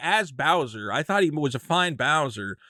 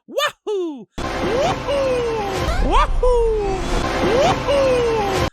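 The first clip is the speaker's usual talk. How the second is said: high, loud, full-throated Mario cheers; energetic Mario wahoo; 5 dB louder, much slower than usual. Retold or imitated. Showing the movie's re-recorded Mario cheer, high and loud. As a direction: belt each wahoo like Mario flying. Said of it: "he really goes".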